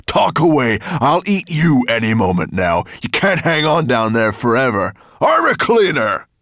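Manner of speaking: loud monster snarl rising to a shout. A narrator's reading. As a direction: threaten your prey, then yell for the servant. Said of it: "he snarled".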